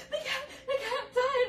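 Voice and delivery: panicked mother, voice up high; frantic mom. Retold or imitated. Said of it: "barks in her".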